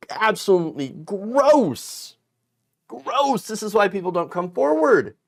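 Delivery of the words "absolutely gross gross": drawn-out, high-pitched disgusted outburst; real disgust. Real, not acted. Commentator condemning a dismissive response, real disgust. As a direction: stretch the word out in revulsion.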